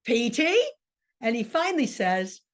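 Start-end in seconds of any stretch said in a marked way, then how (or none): 0.0-0.7 Meg calling a third time. Acted. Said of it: "she says for the third time".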